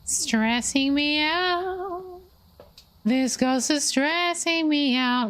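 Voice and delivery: high, slow drawn-out complaint; stressed whine. Real, not acted. Player complains the ghost is stressing him out, slow high whine.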